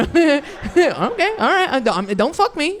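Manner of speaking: high, nervous plea; mock scared pleading. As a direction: beg the big dogs, half-joking. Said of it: "You're like".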